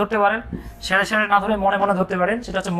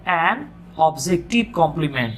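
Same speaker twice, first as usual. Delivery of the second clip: very slow dictation; 4 semitones lower, much slower than usual. Shown as a demonstration. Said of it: "তাই আপনি এটাকে বলবেন".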